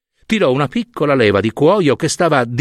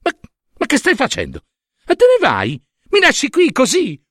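high, rising panicked questions; distressed cry, climbing in pitch; alarmed yell; 10 semitones higher than usual. A narrator's reading. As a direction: call after him in disbelief. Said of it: "urlò Era".

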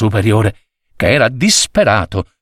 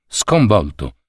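usual speaking voice

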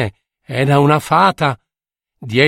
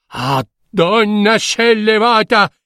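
urlo furioso; 8 semitones higher than usual. Acted. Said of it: "urlò il re".

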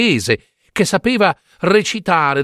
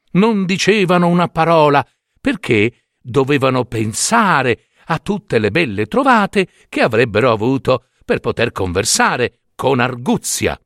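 ordinary delivery, nothing marked

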